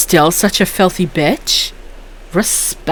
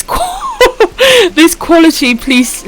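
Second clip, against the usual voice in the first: high shrieking laugh through the words; can't get it out for laughing; laughing hard; 9 semitones higher, much slower than usual. Real, not acted. Cracking up reading chat.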